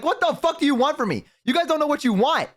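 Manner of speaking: normal talking voice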